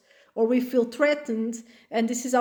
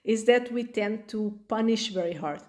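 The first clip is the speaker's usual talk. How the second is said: ordinary delivery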